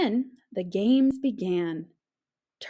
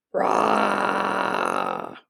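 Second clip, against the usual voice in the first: deep growling snore roar; 4 dB louder than usual. A narrator's reading. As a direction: roar it like thunder.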